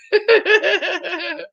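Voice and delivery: a burst of high laughter; laughing out. Real, not acted. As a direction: crack up at the curtsy. Laughs at curtsy.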